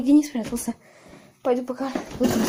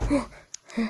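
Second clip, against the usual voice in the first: normal talking voice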